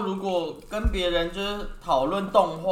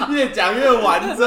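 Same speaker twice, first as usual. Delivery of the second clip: usual speaking voice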